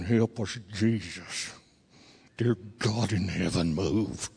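low, very quiet frustrated dad; gritted teeth. Retold or imitated. Upset dad driving home, praying through gritted teeth. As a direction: pray under your breath through gritted teeth. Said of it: "So Dad started gritting his teeth".

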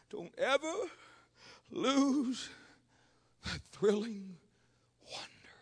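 a hushed, falling close; fading to a whisper. Acted. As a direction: let each word sink until the last is only breathed. Preacher drops from shout to hush.

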